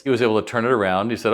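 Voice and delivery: normal talking voice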